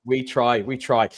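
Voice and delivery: ordinary talk, nothing marked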